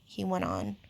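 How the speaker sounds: plain reading voice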